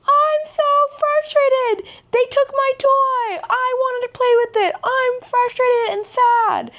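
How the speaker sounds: very high, slow mock toddler wail; tantrumming three-year-old. Aunt acting out nephew's tantrum over a taken toy, mock wailing. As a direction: imitate a small child wailing out his feelings. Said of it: "three-year-old tantrum".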